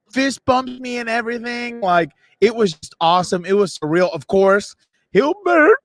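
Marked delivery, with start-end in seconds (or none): 5.1-5.7 mock call. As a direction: sing out the name in a mock holler. Mock calling of brother's name.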